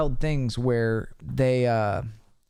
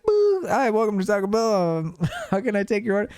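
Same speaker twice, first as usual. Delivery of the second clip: chirpy drive-through greeting; 5 semitones higher than usual. Retold or imitated. Mocks his own drive-through greeting.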